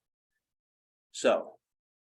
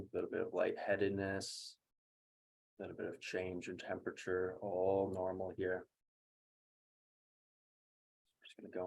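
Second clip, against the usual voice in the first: softer, settled guiding voice; quiet, level coaching through recovery; guided breathwork; 2 semitones lower, 8 dB quieter than usual. Real, not acted. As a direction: talk them gently down after the breathing. Guides recovery after breathing round.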